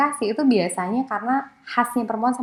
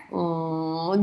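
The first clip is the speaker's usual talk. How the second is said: disapproving hmm; 3 semitones lower, 5 dB quieter than usual. Retold or imitated. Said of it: "ngomong kayak begitu di Jogja mungkin ya kayak".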